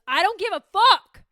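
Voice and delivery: loud, high-pitched shout; brain's outburst. Acted. Her brain answering in a cold shower, shouted outburst. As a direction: yell it as a sudden dismissal. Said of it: "And my brain goes".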